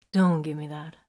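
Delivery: ordinary delivery, nothing marked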